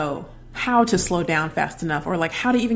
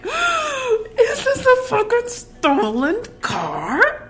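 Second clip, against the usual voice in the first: high, slow, laughing panic; panicked disbelief; 4 dB louder, much slower than usual. Retold or imitated. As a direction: gasp then cry it out. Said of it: "I'm like".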